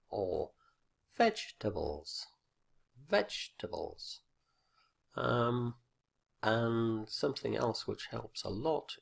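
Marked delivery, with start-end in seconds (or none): 1.2-4.2 vegetables with a heavily punched VEG, then a gap; exaggerated word stress. Shown as a demonstration. Teacher helping learners hear stress, vegetables with exaggerated VEG. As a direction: overdo the first syllable of vegetables. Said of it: "to use some exaggeration".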